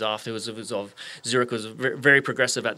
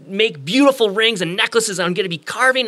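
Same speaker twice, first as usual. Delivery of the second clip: high, gushing anticipation; eager, pitched-up, building energy; all excited; 5 semitones higher, 5 dB louder than usual. Acted. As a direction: act out a boy bubbling over with big plans. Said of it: "and he's all excited".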